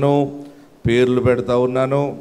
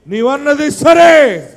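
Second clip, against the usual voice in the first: నీవన్నది సరే slow, higher and louder, సరే falling hard; rising loud repeat of నీవన్నది సరే; loud retort; 10 semitones higher, 7 dB louder, much slower than usual. Acted. Repeated phrase, second take.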